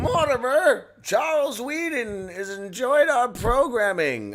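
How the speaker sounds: high, loud, pompous executive voice; a stuffy studio boss gloating over a viewer; mock snooty executive. Voicing Amazon executives mockingly.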